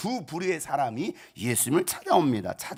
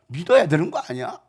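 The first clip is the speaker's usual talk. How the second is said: pointed question at normal pitch; milder reproach; 3 dB louder than usual. Acted. Preacher prodding his flock to believe, mildly reproachful. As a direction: press the point without shouting.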